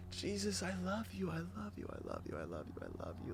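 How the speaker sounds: a touch of tearful begging, fading out; retold weeping prayer. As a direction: recreate the exhausted weeping plea. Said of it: "I was weeping".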